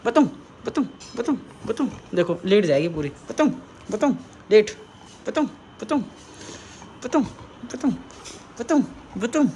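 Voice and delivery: high, punchy teasing of a pet; playful mock threat. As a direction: repeat it in short, high mock-warning bursts.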